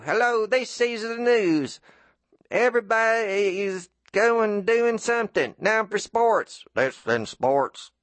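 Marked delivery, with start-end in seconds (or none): 0.0-6.6 higher, slow kid playing a news anchor; a boy with a cassette recorder, hamming up a slow, high newsreader voice; childish newsreader voice. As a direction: announce it like a kid pretending to be on air. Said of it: "I'd do terrible voices".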